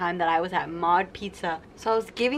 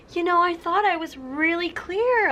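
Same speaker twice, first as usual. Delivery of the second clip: much higher, slow indignant voice; imitating mom's huffy complaint; mock mom voice; 11 semitones higher, 4 dB louder than usual. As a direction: imitate mom acting offended. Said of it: "my mom was like".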